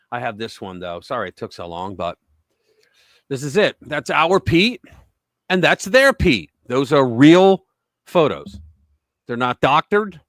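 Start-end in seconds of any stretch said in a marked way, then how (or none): 3.8-6.5 slow, louder, stress on our and their; emphatic reveal. Real, not acted. Showing the two Pete photos to co-hosts, with emphasis. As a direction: savour the contrast.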